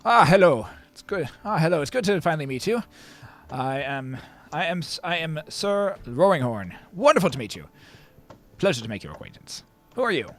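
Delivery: effusive, high-pitched introduction; genteel gentleman greeting. Acted. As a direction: greet a guest with theatrical delight. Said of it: "and he says".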